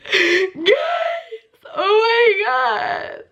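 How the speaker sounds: very high, slow, squealed wail; drawn-out mock happy crying; hammed-up bawling. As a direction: re-enact sobbing to friends in disbelief. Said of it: "I was full on crying".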